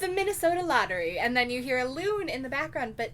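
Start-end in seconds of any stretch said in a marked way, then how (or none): 0.0-1.2 a hint of a radio ad announcer, higher. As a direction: lightly play the lottery ad announcer. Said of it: "it's like".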